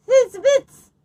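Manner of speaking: very high, loud squeak; a little bird chirping goodbye; bird call. Acted. Flugel bird flying south, high and loud. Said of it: "said the flugel bird".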